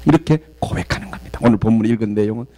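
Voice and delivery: normal talking voice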